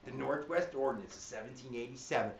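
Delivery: silly mnemonic delivery; cray-cray voice. Shown as a demonstration. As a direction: ham it up so kids remember. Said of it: "saying it in a way where I'm trying to get kids to remember".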